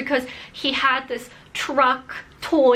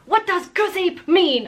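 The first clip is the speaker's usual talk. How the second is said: mock yell; 7 semitones higher, 3 dB louder than usual. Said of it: "she yells at me".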